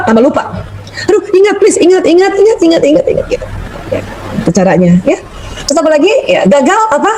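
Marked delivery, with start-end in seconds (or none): 1.1-3.3 high, fretful pleading with yourself; panicky pleading. Retold or imitated. Mock panic of someone who can't remember. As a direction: beg your memory to come back, half-panicked.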